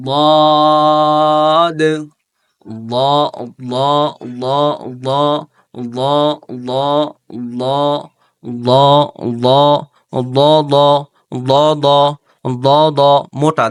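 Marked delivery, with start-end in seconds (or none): 0.1-1.8 ضاد drawn out very long and louder, heavy and thick; dad letter demo. Shown as a demonstration. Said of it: "এই আগাটা এদিক দিয়ে লাগবে". 8.7-13.7 ض- repeated loud and heavy, tongue side on the molars; repeated model sound; dad drill. Shown as a demonstration. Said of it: "দেখছেন?".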